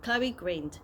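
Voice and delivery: plain reading voice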